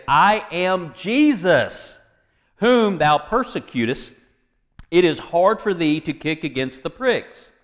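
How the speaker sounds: preacher declaiming scripture, pitch lifted; scripture reading. Acted. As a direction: proclaim the verse to the congregation. Reads scripture aloud to congregation.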